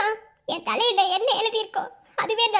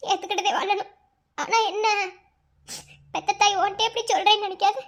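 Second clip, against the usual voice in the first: ordinary talk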